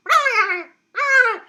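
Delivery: high, loud squealing "ah" noises; mock porcupine cries. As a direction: imitate a porcupine's little whine. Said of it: "be going".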